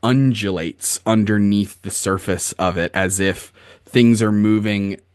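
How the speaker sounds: ordinary talk, nothing marked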